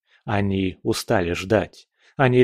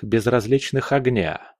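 usual speaking voice

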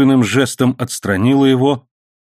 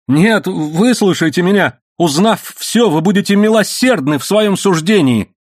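high, urgent begging; frantic high-pitched appeal; desperate pleading; 10 semitones higher, 4 dB louder than usual. A narrator's reading. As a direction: beg to be heard. Accused man begs for mercy.

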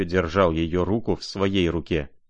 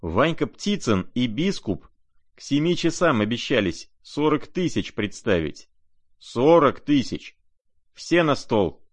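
excited bidding; 6 semitones higher than usual. A narrator's reading. Rogozhin bidding money, excited.

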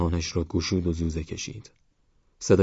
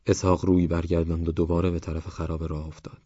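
plain reading voice

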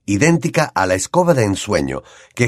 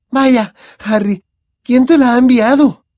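amazed; 6 dB louder than usual. Acted. Hermione gaping at Harry's new broom, amazed.

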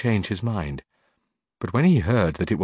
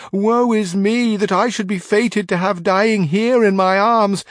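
high, slow mock lament, much higher and louder; hammed-up wailing; 11 semitones higher, 5 dB louder than usual. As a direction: wail as a fake grieving maid. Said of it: "began to weep bitterly".

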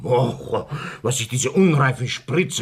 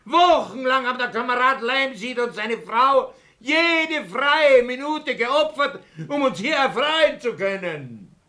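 indignant; 10 semitones higher than usual. Acted. Reproaching the hecklers, high pitched.

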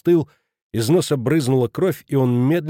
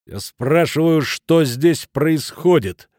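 slow, raised, commanding repeat; menacing; 4 semitones higher, 4 dB louder, much slower than usual. A narrator's reading. Newcomer demanding an answer from the room, menacing. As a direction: demand it sternly, spacing each word. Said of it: "грозно повторил".

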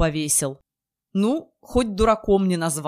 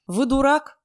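mocking; 6 semitones higher than usual. A narrator's reading. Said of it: "с издёвкой".

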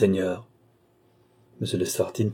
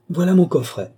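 high, loud cry of discovery; exclaiming; 8 semitones higher, 6 dB louder than usual. A narrator's reading. Countess pointing out her own casket, exclaiming. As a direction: exclaim it brightly, pitched up and louder. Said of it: "s'écria la comtesse".

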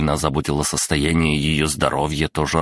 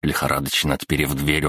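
usual speaking voice

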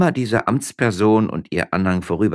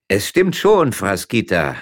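louder, calling from above; 5 semitones higher, 7 dB louder than usual. A narrator's reading. Lukas calling down from the trellis to his wife. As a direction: call down from the vine. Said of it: "rief Gevatter Lukas".

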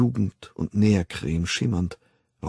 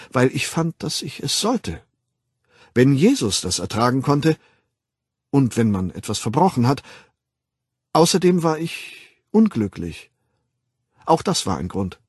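put-on higher-pitched, insistent young man; 4 semitones higher, 3 dB louder than usual. Young man explaining his ordeal to Bernard, earnest and insistent. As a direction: justify yourself earnestly, pitch up.

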